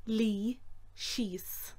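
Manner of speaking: slow whispered Lí síos. Shown as a demonstration. Teacher quietly repeating a dog command.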